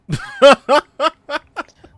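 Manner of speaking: loud, high burst of laughter; real high cackle at yourself; laughing. Real, not acted. Host laughing after admitting he can't read. Laughs at own failed reading.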